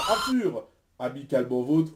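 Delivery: normal talking voice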